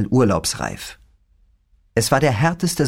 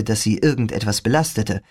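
ordinary delivery

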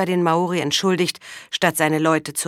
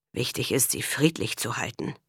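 low, softer grumble; grumbling; 5 semitones lower, 7 dB quieter than usual. A narrator's reading. Karl answers the priest, grumbling low and soft. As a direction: mutter it under your breath, lower. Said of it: "brummte Karl".

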